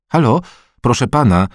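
mother calling after the man, loud and high. Acted. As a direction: call out politely but firmly to get his attention. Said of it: "zawołała".

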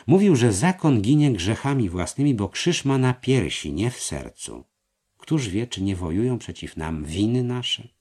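stirred. A narrator's reading. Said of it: "mistrz wstał poruszony".

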